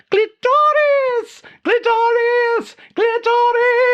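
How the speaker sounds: very high, slow, drawn-out fake German wail; desperate echoing cry. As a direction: professor dragged away, crying out desperately. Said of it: "her voice echoed desperately behind her".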